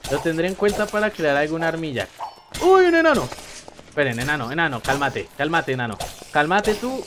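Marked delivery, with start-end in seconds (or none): 2.6-3.3 high loud surprised cry; startled yelp. Real, not acted. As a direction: jump at the sight. Sudden dwarf appears. (grito de sorpresa agudo)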